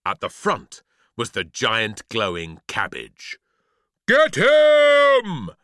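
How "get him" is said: huge high roar; booming. A narrator's reading. Giant cabbage sets the mob on the boy, booming. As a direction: bellow the order to attack. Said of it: "it boomed as it bounced along".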